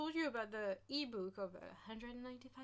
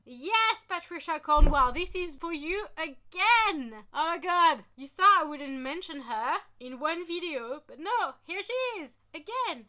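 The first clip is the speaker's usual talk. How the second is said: louder and higher, teasing; loud mock callout; 6 semitones higher, 12 dB louder than usual. Real, not acted. Vlogger loudly teasing author Patricia Cornwell again. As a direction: call her out with glee.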